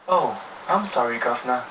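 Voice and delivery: a hammed-up bad British impression; bad British accent. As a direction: tease him in a poorly faked British accent. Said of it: "poorly imitating my British accent".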